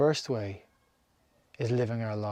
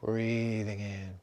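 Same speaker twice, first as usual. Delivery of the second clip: guided meditation; slower than usual. Acted. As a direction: lead them calmly from breath to breath. Leads a breathing meditation.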